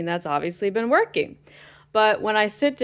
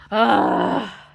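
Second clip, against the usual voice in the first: loud, higher exasperated cry of frustration; frustrated cry; 4 semitones higher, 6 dB louder than usual. Real, not acted. Fed up with her insurance, a loud frustrated cry. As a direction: let out a raw, fed-up wail.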